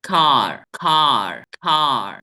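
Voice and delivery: कार with a clear curled r at the end, said loud; teacher drilling कार for the class to repeat; model American car. Shown as a demonstration. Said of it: "तीन तीन बार बोलता हूँ".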